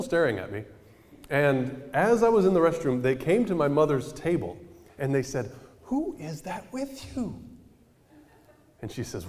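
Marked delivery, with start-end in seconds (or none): 5.8-7.4 put-on high, slow curious old lady voice; lightly voiced curious old lady; older lady asking. Old woman at Denny's asking the mother who he is, high and curious.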